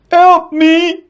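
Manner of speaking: high, frightened cry; scream for help. A narrator's reading. Dragon jumping in the air, screaming in fear. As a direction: shriek it in panic. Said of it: "he screamed".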